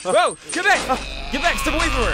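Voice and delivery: sudden high panicked yelling; frantic warning shouts; alarmed shout. Acted. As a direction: shout in shock as she lunges. Zombie attack in the lab.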